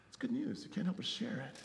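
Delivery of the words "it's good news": whispered. Real, not acted. Sighing wonder at good news.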